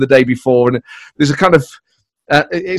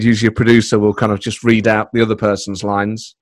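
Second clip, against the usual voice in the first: normal talking voice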